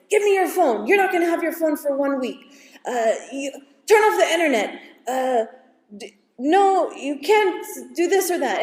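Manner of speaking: angry parent. Retold or imitated. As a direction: fire off punishments like a furious mom. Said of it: "So many times what we do right away is".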